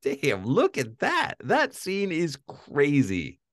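high, slow, drawn-out marvelling; amazed. Retold or imitated. Reviewer recalls watching the scene three times, amazed. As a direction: stretch each word in awe, pitch lifted.

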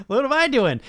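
high, loud, stunned self-question; sudden realization. Retold or imitated. Coach realizing his blind spot, exclaims in surprise. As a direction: catch yourself mid-mistake, amazed.

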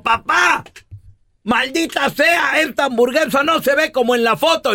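queja aguda y alargada de niño; reclámale a papá con la voz alta de tono; niño frustrado. Acted. (berrinche de niño decepcionado)

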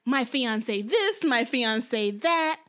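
slow, drawn-out bragging imitation; imitating a woman flaunting, very slow; mock showing off. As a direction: stretch each phrase, mocking her boasting. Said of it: "She tried to stunt and is like".

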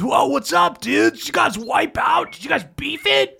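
high, laid-back surfer voice; hey-dude beach bum greeting. Acted. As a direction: chill surfer ape checking on them. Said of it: "he goes".

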